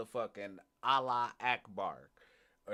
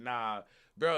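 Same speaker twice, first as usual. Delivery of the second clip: ordinary talk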